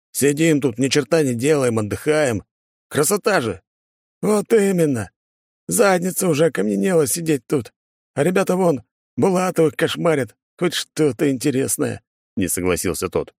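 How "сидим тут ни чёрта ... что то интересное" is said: higher-pitched character voice, lively griping; bantering guardsmen. Acted. Two guards argue over their idle post, bantering. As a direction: voice a soldier chatting on watch, pitched up.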